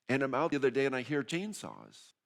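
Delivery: usual speaking voice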